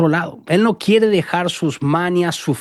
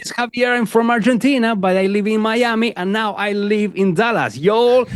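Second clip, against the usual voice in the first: greeting the church; 5 semitones higher than usual. Retold or imitated. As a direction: call out warmly to the congregation. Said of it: "agarro el micrófono y digo".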